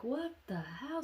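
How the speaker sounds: high-pitched, rising disbelief; shocked disbelief. Retold or imitated. Group seeing him shapeshift, shocked disbelief. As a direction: let it climb, aghast.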